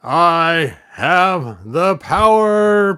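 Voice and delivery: slow, loud, high heroic proclamation; heroic shout. Acted. Hero transforming into He-Man proclaims loudly and slowly. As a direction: stretch each word out like a triumphant champion.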